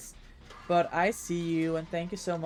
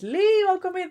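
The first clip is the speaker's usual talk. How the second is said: excited greeting; 12 semitones higher, 6 dB louder than usual. Real, not acted. Streamer greets a chatter.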